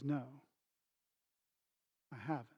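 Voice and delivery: very quiet, low reply; small, hushed confession; sheepish admission. Retold or imitated. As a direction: imitate a humbled son, long pause midway. Son sheepishly admits it.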